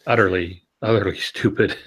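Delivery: ordinary talk, nothing marked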